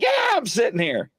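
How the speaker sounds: high, exclaimed comeback; high-pitched retold exclamation; retold worked-up reply. Retelling own startled reply.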